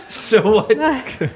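laughing high so what; half laughing through the words. Real, not acted. Laughing at her confusion.

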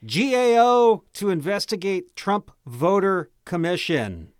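mock news anchor reading a headline. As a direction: announce it like breaking news.

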